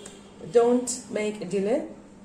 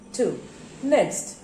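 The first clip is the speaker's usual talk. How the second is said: ordinary talk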